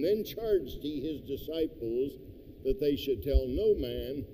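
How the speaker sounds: normal talking voice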